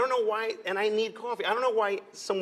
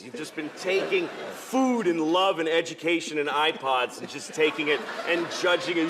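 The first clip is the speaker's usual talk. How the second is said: usual speaking voice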